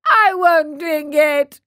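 slow, loud, high wail; bursting out crying. A narrator's reading. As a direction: refuse in a childish acted cry. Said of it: "cried Pinocchio, bursting out crying".

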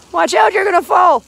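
loud high warning call. Real, not acted. Mom warning her son not to fall, loud call.

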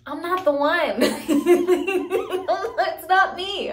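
laughing. Real, not acted. Telling viewers she's not the one, laughing.

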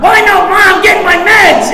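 high, loud brush-off; mock yell to shut her down; loud defensive. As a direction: get loud so she backs off. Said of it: "we think if we get loud enough".

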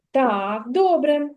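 ordinary talk, nothing marked

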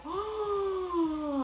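long, high "oh" of wonder; amazed gasp. Real, not acted. Reacting to the tattooed hair, amazed gasp. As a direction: gasp in delighted surprise.

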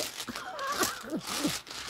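soft, high wheezy laugh; helpless squeaky giggle. Real, not acted. Streamer may do a freestyle, soft high giggling.

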